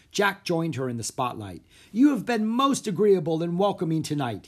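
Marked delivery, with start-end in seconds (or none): 1.9-4.5 raised, higher showman's voice; stage shout. A narrator's reading. Magician thanks the theater audience, shouted. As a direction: project grandly to the audience. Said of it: "he shouted".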